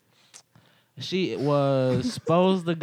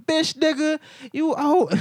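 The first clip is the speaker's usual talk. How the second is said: high, loud mock shout throwing someone out; imitating an angry kickout; 7 dB louder than usual. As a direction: yell it like tossing them out.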